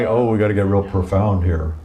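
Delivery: lightly mocking solemn tone; mock profound. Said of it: "you wanna".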